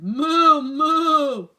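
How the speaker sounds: high, loud mooing; hammed-up cow; cow mutter. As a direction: moo like a confused cow. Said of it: "who muttered".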